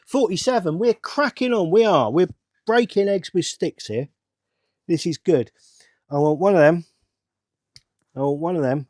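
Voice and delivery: loud, high, excited burst. Real, not acted. Builder cheering on his own progress, excited. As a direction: celebrate your progress at full voice.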